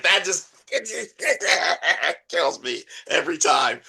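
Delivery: words broken up by real laughter; can't get through it for giggling; laughing. Real, not acted. Laughing at a favourite gag.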